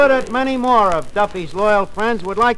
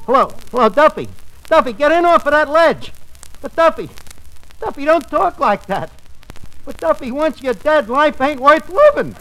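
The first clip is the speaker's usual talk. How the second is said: pleading; 6 semitones higher than usual. Acted. Pleading with Duffy on ledge.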